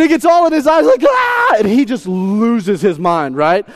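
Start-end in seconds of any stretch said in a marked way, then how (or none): 1.1-1.6 put-on high loud baby shriek; a sharp shrieking wail; baby's cry. Baby crying out at the changing table, high shriek.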